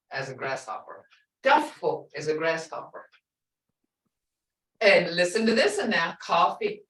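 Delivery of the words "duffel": "Duffel" with short uh before f; correct short vowel. Shown as a demonstration. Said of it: "It's gotta be duffel".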